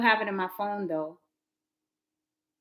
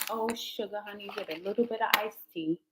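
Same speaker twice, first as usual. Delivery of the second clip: muttered mock curse; 9 dB quieter than usual. Real, not acted. Mild curse while grabbing cards.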